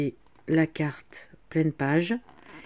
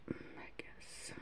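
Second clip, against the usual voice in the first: whispering; 24 dB quieter than usual. Real, not acted. Annoyed aside, whispered.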